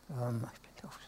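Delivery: breathy, voiceless mutter to himself; whispered aside. Real, not acted. Preacher searching for the song, whispering to himself. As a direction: whisper it while hunting for the page.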